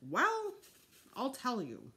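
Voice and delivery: high squawk then settling; squawky bird voice. A narrator's reading. Fudge speaking as his mynah bird, high squawk. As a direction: play the talking bird, chirpy.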